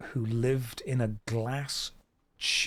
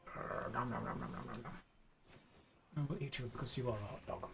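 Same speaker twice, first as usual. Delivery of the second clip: soft, lower playful eating noises; quiet mock-munching baby talk to a dog; playful nom nom; 5 semitones lower, 15 dB quieter, faster than usual. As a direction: pretend to gobble up the puppy. Pretending to eat the dog.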